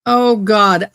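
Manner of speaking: normal talking voice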